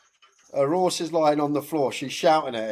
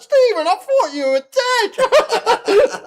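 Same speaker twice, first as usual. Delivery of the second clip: put-on slow, much higher, louder line in a broad Scottish accent, laughing; thick Scottish accent; 11 dB louder, much slower than usual. Scottish woman relieved her horse stood up, thick Scottish accent. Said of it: "in a thick Scottish accent".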